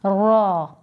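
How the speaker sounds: रा with a full, heavy, rounded r. Shown as a demonstration. Teacher shows pupils a heavy, full रा. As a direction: say रा thick and full. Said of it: "इसको हम पढ़ेंगे पुर".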